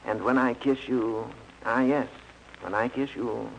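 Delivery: a light, slow crooning matinee idol; lightly hammed-up suave lover, drawn out; mock film romance, slow and soft; Ronald Colman romantic. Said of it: "like Ronald Colman and say".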